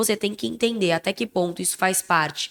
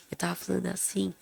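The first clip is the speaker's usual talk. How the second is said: much softer, dropped volume; low sick-day voice; 11 dB quieter, faster than usual. Shown as a demonstration. Recalling her weak voice when a bit ill, hushed. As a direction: show how softly you were talking while poorly. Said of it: "pra mim eu tava sussurrando".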